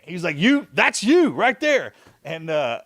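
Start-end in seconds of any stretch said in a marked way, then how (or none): 0.4-1.9 high, drawn-out mock accusation; retold going off. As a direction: go off on him, pitched up and stretching the words. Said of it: "he really went off on me".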